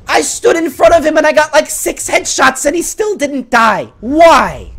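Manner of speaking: high, loud, exasperated outburst; shouted gamer frustration; frustrated shout. Real, not acted. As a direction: yell it in disbelief. Frustrated rant about the gun.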